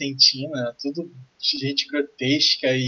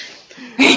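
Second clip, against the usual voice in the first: gleeful laugh; 9 semitones higher, 11 dB louder than usual. Acted. Pirate NPC gloats over plan.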